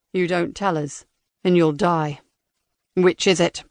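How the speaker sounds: cold robotic interrogator. Acted. Interrogator threatens.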